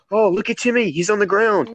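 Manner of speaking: lightly voiced cartoon character; movie character quote. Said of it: "There was a scene where he goes".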